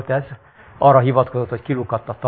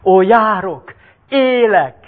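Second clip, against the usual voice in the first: joyful cry; 8 semitones higher, 4 dB louder, slower than usual. Acted. Healed man rejoicing.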